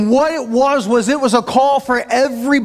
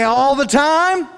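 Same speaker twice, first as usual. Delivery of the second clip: slow preacher call, a bit higher; pastor's call; 5 semitones higher, slower than usual. Retold or imitated. Pastor calls out to the congregation, slow and lifted. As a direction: draw out the call like a Pentecostal pastor. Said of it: "the pastor would say".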